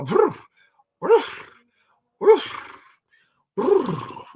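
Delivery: a high mock "roof" bark after a rolled growl; growl, then yap like a pit bull; imitated dog growl and bark. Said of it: "She'll go".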